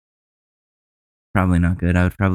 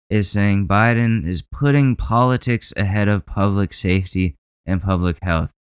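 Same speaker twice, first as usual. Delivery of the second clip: ordinary delivery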